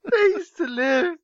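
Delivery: high-pitched, helpless giggling read; laughing while reading. Real, not acted. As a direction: struggle to read it out through rising laughter. Reader cracking up while reading aloud.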